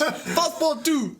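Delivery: high, loud call of the servant's name; master calling. Acted. Fogg summoning his new servant, high and loud. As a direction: call across the house for him.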